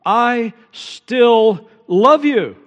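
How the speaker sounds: slow, high, loud proclamation; climactic cry. Acted. Preacher voicing God's love to Jacob, slow loud cry. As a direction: draw out each word as a tender shout.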